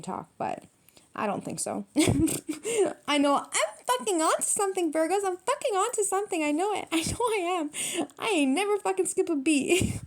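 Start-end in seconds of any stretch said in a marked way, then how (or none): 1.9-10.0 high-pitched, real giggly excitement; giddy laughing. Real, not acted. Reader sure she's onto something, giddy high laughing boast. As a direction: crow it through bubbling laughter. Cracks up at own reading.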